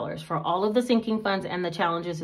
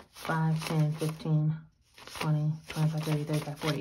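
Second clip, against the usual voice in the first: soft low bill count; quiet counting while handling money; counting under breath; 3 semitones lower, 6 dB quieter than usual. Real, not acted. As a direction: murmur the count softly to yourself.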